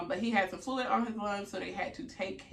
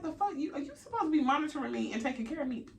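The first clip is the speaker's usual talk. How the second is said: fast, high-pitched retold outrage; indignant; 7 semitones higher, faster than usual. Patient to inattentive anesthesiologist, indignant. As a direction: rattle off an exasperated challenge.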